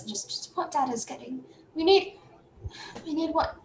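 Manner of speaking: high, soft, hesitant little voice; small child character. Acted. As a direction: answer like an unsure little kid. Young Willen answers softly.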